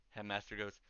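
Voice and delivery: ordinary talk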